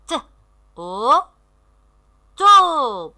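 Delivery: each sound alone, then joined and held; teacher modelling drawn-out syllables; syllable blending. Shown as a demonstration. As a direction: sound out letters slowly, then blend them. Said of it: "lisez les syllabes".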